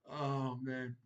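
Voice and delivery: ordinary talk, nothing marked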